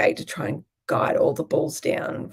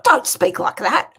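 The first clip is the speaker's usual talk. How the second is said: loud, higher imitated reprimand; dad's scolding; 4 semitones higher, 8 dB louder than usual. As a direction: snap it like an offended farmer dad. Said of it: "he's like".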